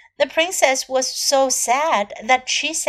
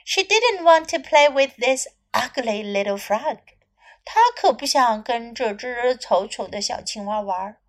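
usual speaking voice

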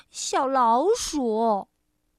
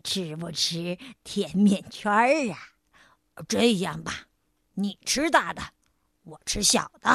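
big tiger voice; 8 semitones lower than usual. A narrator's reading. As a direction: offer it gently, deep voice. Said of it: "大老虎又说". (低沉讨好的大老虎腔)

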